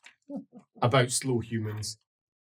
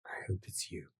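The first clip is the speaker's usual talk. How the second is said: soft, voiceless whisper; barely audible elf murmur; whispered aside; 16 dB quieter than usual. Acted. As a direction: whisper it like a private hope. Elf picks someone to talk to.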